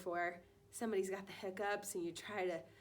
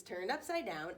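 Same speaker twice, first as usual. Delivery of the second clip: ordinary talk, nothing marked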